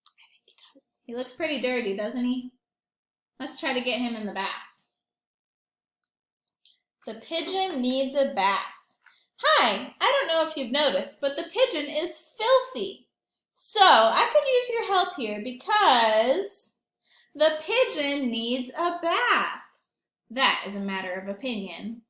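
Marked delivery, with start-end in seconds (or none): 0.1-1.0 conspiratorial whispered aside. Real, not acted. Aside to the children. 9.4-19.6 higher, louder, pleading helper; slow down and plead on the last line; eager character voice. Acted. Character addresses the reader.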